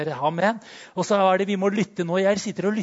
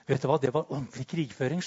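usual speaking voice

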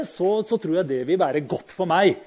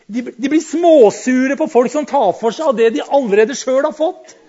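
laughing through God's complaint, higher and louder; God's words, laughing; 7 semitones higher, 9 dB louder than usual. Acted. Preacher voicing God's complaint about his children, laughing. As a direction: let the quote break into a laugh. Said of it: "siden Gud sier".

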